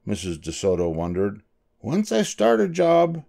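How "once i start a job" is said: high, slow and resolute mouse; determined little character voice; firm. A narrator's reading. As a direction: state a principle, measured and high. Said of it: "said the dentist firmly".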